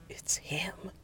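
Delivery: very soft, voiceless whisper. A narrator's reading. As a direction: barely breathe it. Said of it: "Cassidy whispered".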